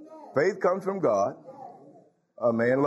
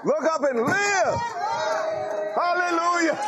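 ecstatic call; 12 semitones higher than usual. Real, not acted. Preacher calling the church to look up, ecstatic and high. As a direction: lift it up like a shout of joy.

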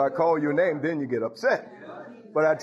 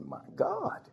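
God exclaiming; 9 dB quieter than usual. Acted. Preacher voices God exclaiming.

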